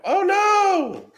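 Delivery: high, sarcastic oh no; mock shock. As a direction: fake dismay, dripping sarcasm. Reacting to a reported channel.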